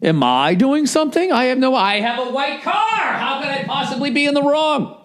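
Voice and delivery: high, put-on baffled driver voice; mock bewilderment. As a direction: climb into a very high, indignant squeal of disbelief. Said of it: "looking at me like".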